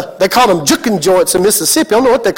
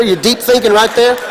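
ordinary delivery

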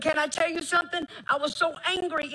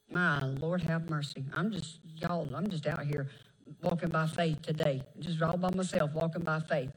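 low, soft confession; 10 semitones lower, 5 dB quieter than usual. Real, not acted. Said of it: "preacher's lowered personal moment".

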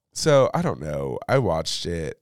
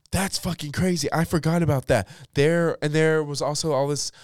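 much higher, loud disbelief; shocked outburst; 8 semitones higher, 4 dB louder than usual. Real, not acted. Podcaster reacting to [name] story, high excited disbelief. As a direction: exclaim it, stunned.

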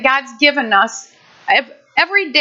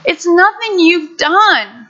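higher, slow, gentle and comforting; reassuring God; 7 semitones higher, slower than usual. Retold or imitated. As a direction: soothe a frightened child as a loving Father. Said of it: "And He's like".

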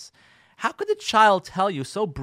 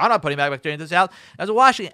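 fast defensive retort; 9 dB louder, much faster than usual. Acted. Husband snaps back.

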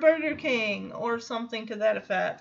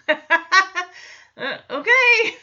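laughing okay; 7 semitones higher, 8 dB louder, much slower than usual. Retold or imitated. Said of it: "DJ answering duck request".

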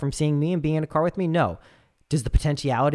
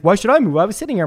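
much louder, higher indignant protest; retold protest; 6 semitones higher, 9 dB louder, faster than usual. Stranger protesting to Noah, loud and higher. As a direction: sound affronted.

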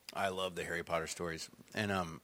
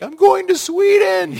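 big high shout of glee; excited shout; 19 dB louder, slower than usual. Pretend. Imagining his younger self shouting about a trip to Sweden, excitedly. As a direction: yell it like a thrilled twenty-year-old. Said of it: "I'd be like".

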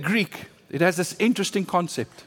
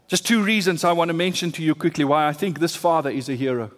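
normal talking voice

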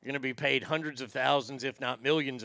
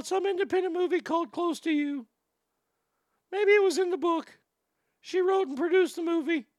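much higher, mocking nasal-free boyish voice of Michael Cera; Michael Cera impression; 13 semitones higher than usual. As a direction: play the awkward boy, pitched way up to mock him. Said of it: "Arnie had Michael Cera in the studio".

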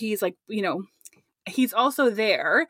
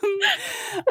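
high giggle; 10 semitones higher than usual. Real, not acted. Laughter after naming the mocked character.

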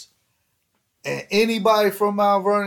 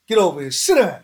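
loud, higher orders to a dog; loud retold orders to a dog; firm commands; 3 semitones higher, 7 dB louder, faster than usual. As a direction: boss the dog around.

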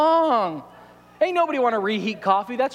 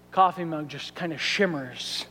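normal talking voice

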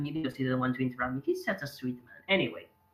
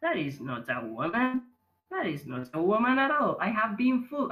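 starts level, then shoots higher on the second line; dawning disbelief; 7 semitones higher than usual. Retold or imitated. Retelling a friend's selfie reveal, rising mock dismay. Realizing selfie shows a man.

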